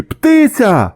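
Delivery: high, excited call-out; shouting from afar. A narrator's reading. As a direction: yell the sighting to the whole crew. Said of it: "закричали з баржі".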